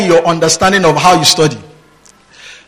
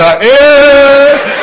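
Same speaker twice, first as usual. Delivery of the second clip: mock baby squeal; 10 semitones higher, 8 dB louder than usual. Imitates a baby's exclamation.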